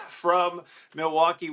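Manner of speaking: usual speaking voice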